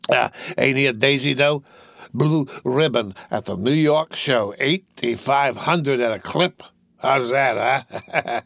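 slow, boastful tipsy drawl; drawn-out, slurry bragging; tipsy young master. Acted. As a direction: show off the dog with woozy pride.

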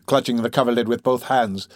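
ordinary delivery, nothing marked

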